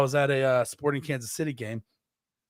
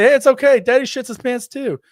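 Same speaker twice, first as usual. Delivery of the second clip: much higher and louder, bright comforting parent; cheerful reassurance; 10 semitones higher, 9 dB louder than usual. Shown as a demonstration. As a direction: show parents how to cheer up their kid.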